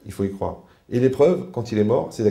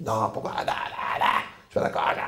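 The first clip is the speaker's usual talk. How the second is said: breathy mock refusal. Imitating someone refusing a loss.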